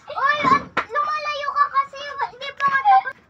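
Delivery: high and loud, squirming; pleading while being tickled. Real, not acted. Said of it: "Nakikilig siya ako eh".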